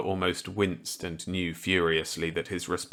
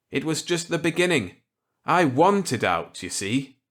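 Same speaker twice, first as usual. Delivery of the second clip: higher, louder voice for kindly Millet; Millet's voice; 8 semitones higher, 4 dB louder than usual. Acted. Millet offering Walker a gambit, kindly. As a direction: play the genial old scientist warmly.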